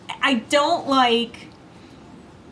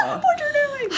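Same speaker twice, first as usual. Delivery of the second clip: laughing squeal; slower than usual. Retold or imitated. Mimicking friend's frantic plea.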